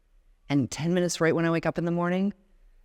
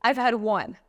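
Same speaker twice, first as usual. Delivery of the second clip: tearful reply; 6 semitones higher, 4 dB louder than usual. Retold or imitated. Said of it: "I burst into tears and I go".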